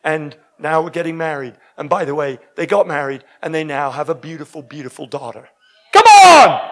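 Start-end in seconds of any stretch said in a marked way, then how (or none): 5.9-6.6 high, loud burst to the crowd; rousing shout. Real, not acted. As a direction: fire up the room with a sudden shout. Preacher rousing the audience after testimony.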